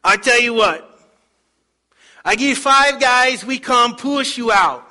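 loud, high put-on foreign accent; Speedo man's accent. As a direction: offer help in broken English, booming. Speedo man's broken-English offer.

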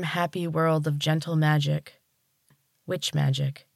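ordinary delivery, nothing marked

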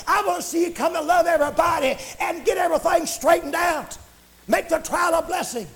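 high, insistent revival preaching; fervent preaching. Real, not acted. Preacher urging church to use the love of God, fervent preaching. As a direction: exhort the church with driving fervor.

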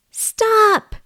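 high squeaky call of stop; small mouse. Acted. Mouse calling Ed back, high little voice. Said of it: "the mouse says".

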